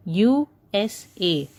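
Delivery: "U S A" as three separate letters; spelled out. Shown as a demonstration. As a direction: space out each letter of "U S A". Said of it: "You literally pronounce the letters".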